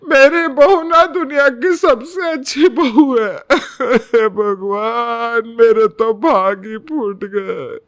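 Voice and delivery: loud, hammed-up sobbing wail; fake crying. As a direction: wail to make a scene for the guest. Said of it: "रो रो कर तमाशा खड़ा कर देती है". (रो-रोकर नाटकीय तमाशा)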